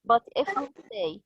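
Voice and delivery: ordinary talk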